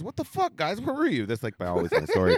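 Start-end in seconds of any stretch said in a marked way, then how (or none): 0.0-1.2 much higher, incredulous complaint; scolding the bartenders, high and incredulous; exasperated outrage. Retold or imitated. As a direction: demand it, voice cracking up. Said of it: "telling the bartenders".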